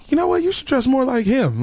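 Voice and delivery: mock mom. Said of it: "she was like".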